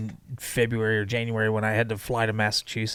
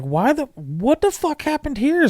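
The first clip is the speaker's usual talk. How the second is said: much higher, shocked outburst; retold disbelief. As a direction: blurt it out baffled. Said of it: "And I was like".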